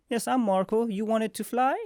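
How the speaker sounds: high, bright put-on Marco; playful Marco voice. Marco appearing out of nowhere to offer flight, high put-on voice. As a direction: pop in cheerfully to offer a lift. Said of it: "Marco's like".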